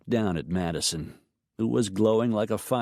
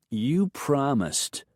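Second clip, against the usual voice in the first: put-on high, slow knowing child; a kid gently holding you to it; sage little girl; 7 semitones higher, much slower than usual. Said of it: "Madison reminded her sagely".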